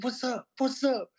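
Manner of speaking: very high imitated challenge, repeated; mock taunting. Skater kids goad him by the bathroom, mock taunting. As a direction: mimic a kid goading someone into a fight.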